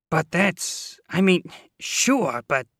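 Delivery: high, loud, slow stammer; flustered. A narrator's reading. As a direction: stammer out excuses. Landlady flustered excuses.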